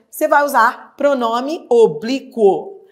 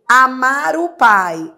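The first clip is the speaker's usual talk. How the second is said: "Amar o pai" slow and louder, each word set apart; slow emphatic demo; 3 semitones higher, 6 dB louder, slower than usual. Shown as a demonstration. Teacher models the example sentence, slow and emphatic. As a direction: pronounce "Amar o pai" slowly, leaning on it. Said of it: "Na sequência vem o pronome o".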